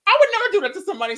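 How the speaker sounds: very high, loud, fast outburst; indignant agreement. Retold or imitated. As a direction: chime in with heated agreement. Acts out agreeing indignantly with friends.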